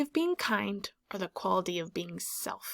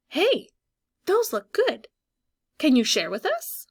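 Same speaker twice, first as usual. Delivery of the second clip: higher, louder birds begging for a treat; 5 semitones higher, 5 dB louder than usual. Pretend. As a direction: voice the hungry birds asking to share. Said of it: "speech bubble by the birds".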